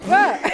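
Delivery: high loud burst of laughter; shrieking laugh. Real, not acted. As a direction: break into helpless laughter.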